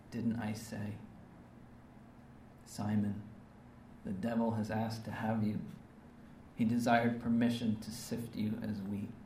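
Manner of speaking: tender hushed Jesus. Acted. Voicing Jesus tenderly to Peter.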